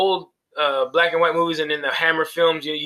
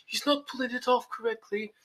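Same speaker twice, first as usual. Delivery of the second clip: higher, softer put-on British accent; mock British accent; 7 semitones higher, 9 dB quieter than usual. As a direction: mimic an actor's bad attempt at British. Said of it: "trying to do a British accent".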